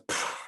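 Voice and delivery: scoffing puff of air; dismissive pfft. Retold or imitated. Teenage son scoffing at his father, dismissive. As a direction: blow off dad with a scoff. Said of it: "the kid, of course, goes".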